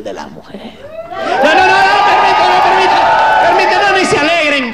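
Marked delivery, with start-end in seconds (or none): 1.4-4.7 high, loud, insistent; shouting down cheering women; loud interruption. Real, not acted. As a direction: cut the crowd off firmly. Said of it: "stops the audience".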